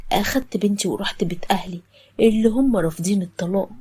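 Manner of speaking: usual speaking voice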